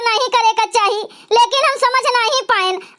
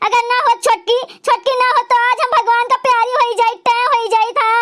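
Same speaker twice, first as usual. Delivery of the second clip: usual speaking voice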